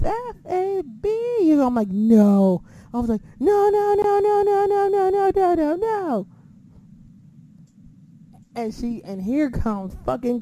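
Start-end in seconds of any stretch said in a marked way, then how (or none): 0.0-1.1 high, drawn-out entrance cry; mimicked entrance call. Imitating what was heard on the ramp, high drawn-out call. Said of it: "all you hear is". 1.9-2.6 loud, drawn-out no; retold protest. Retelling a reaction to the ramp entrance, loud protest. 3.4-6.3 high, loud string of noes on one held pitch; retold frantic protest. Retelling a reaction to the ramp entrance, frantic protest.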